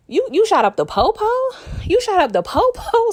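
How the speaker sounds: high-pitched imitation of shocked disbelief. Scrappy questions Kanan about the police shooting, mock-shocked. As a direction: act out an astonished double-take question. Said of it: "a little shocked at him".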